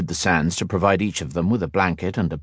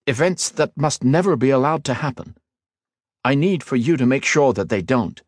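higher, earnest and steady; earnest hero voice; 7 semitones higher than usual. A narrator's reading. Hero reassures his eager cousin, earnest and steady. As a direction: promise him, then lay out the mission. Ethan explains to Liniel.

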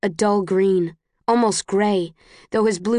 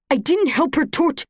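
cut-off plea; 8 semitones higher than usual. Acted. Caleb cut off mid-denial.

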